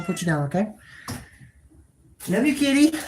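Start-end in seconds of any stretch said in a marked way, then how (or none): 2.3-3.0 high, sweet voice to the cat; tender pet talk. Real, not acted. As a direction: coo it to the cat.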